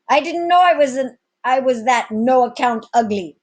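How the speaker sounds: high, loud rough cook's voice; laughing cook. Acted. Cook Baho joking to the captain, high and loud. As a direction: joke back at the captain. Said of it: "the cook, laughing".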